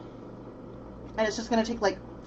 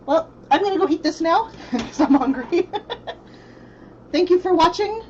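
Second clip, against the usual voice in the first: laughing goodbye, louder; 7 semitones higher, 5 dB louder than usual. Real, not acted. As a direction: laugh through the sign-off. Laughing sign-off.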